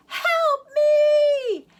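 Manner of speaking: very high, loud desperate scream; screaming for help. Acted. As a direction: scream as loud as you can. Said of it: "he screamed as loud as he could".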